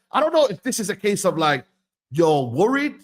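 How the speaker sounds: ordinary talk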